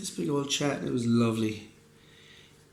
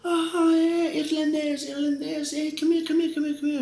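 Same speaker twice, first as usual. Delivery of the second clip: much higher, loud, happy greeting voice; imitating Perez; 4 dB louder than usual. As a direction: call him over with big delight, pitched way up. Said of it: "He goes".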